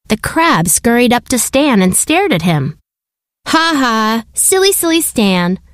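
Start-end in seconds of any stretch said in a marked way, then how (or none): 3.5-5.6 a higher, lightly put-on taunting bird voice; mocking seagulls. Seagulls calling from a rock, mildly mocking Stan. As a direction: laugh and taunt like a cheeky gull. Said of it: "the seagulls called".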